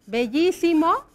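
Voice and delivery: "Bellísimo" with a strong "llí", tune rising at the end; stress on í in Bellísimo. Shown as a demonstration. Teacher prompting pupils for the accent, "Bellísimo" stressed on the í. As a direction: lean on the í of "Bellísimo". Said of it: "tiene acento en la I".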